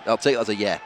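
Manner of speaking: ordinary talk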